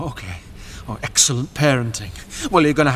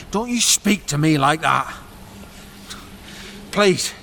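ordinary talk

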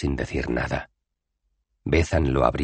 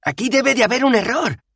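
high, strained protest; a nervous citizen objecting, pitched way up; citizen's voice; 5 dB louder than usual. Acted. Citizen protesting to the crowd, high and anxious.

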